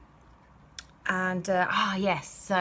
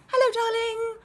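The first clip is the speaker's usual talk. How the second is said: high sing-song hello, much higher and louder; sing-songy greeting; 7 dB louder than usual. Retold or imitated. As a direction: trill it like a cheery mum. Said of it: "in a sing-songy voice".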